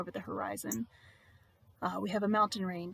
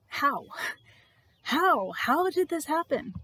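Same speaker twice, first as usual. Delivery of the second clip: higher, incredulous questioning; stunned bewilderment; 6 semitones higher, 4 dB louder, slower than usual. Acted. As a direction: ask it as if your mind is reeling. Voicing the disciples' stunned questions.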